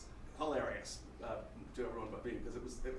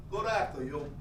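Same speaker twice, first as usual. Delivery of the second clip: New York passerby; 6 dB louder than usual. Retold or imitated. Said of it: "it's just very New York".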